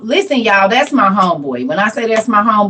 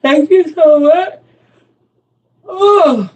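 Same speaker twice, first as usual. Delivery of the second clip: gushing thanks; 8 semitones higher, 4 dB louder, much slower than usual. Real, not acted. Thanking a viewer.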